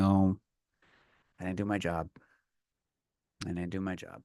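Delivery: a low disappointed mumble under his breath; quiet self-scolding mutter. Retold or imitated. Scene: itchy at work, scolding himself in a mutter. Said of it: "and going".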